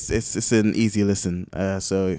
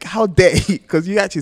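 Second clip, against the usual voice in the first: mock outrage; 8 semitones higher, 6 dB louder than usual. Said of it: "I was listening to this getting angry".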